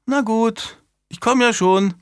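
put-on high-pitched child giving in; grudging child answering the governess; reluctant little girl. A narrator's reading.

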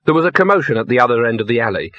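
ordinary delivery, nothing marked

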